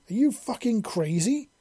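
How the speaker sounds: high, shocked angry outburst; angry exclamation. A narrator's reading. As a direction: blurt it out in angry disbelief. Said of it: "she exclaimed angrily".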